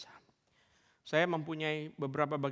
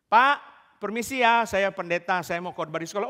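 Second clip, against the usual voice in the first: loud call up to the school, pitched higher; 5 semitones higher, 10 dB louder than usual. Retold or imitated. Preacher calling up to the school for permission, loud call. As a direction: call up the hill to be heard.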